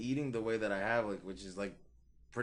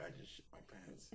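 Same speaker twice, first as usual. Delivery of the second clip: breathy whisper, almost no voice; whispered thought; 4 semitones lower, 18 dB quieter than usual. Retold or imitated. Waking up and realising it, whispered to himself. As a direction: mouth it to yourself in horror.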